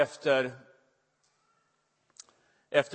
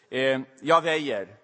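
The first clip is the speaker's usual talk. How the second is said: ordinary delivery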